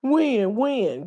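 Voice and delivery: high, falling mock question; lightly mocking repeated question; mocking feigned ignorance. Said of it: "I can't stand 'em up like that to be like".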